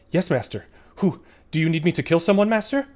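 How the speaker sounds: high, loud and eager, like a devoted hound; a loyal summoned dog answering its master, eager and high; eager magic dog voice. Acted. Dog offers to kill someone.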